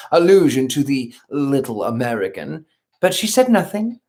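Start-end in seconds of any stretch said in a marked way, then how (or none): none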